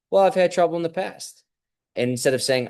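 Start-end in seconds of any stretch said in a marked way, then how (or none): 0.1-1.4 higher, lightly voiced answering voice; calm confident reply. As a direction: answer the doubter much higher, unbothered. Imagined reply in self-talk dialogue.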